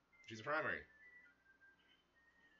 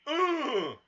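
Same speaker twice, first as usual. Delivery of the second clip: long, high, loud held yell; loud high cry; 16 dB louder than usual. Real, not acted. Player in mid fight, loud high cry. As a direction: let out a drawn-out cry at full volume.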